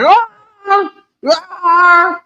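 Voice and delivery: high mock dog roar; imitated dog roar. Imitating a friend's dog, high mock roaring. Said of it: "sound like it's hollering".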